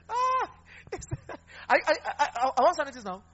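words broken up by laughter; laughing. Real, not acted. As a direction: laugh while pushing the line out. Laughing through his point.

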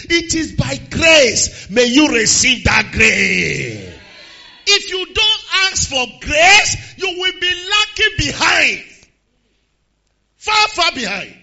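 slow, loud preacher shout; preaching. Acted. Preacher shouting slowly to the church. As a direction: drive it home to the congregation.

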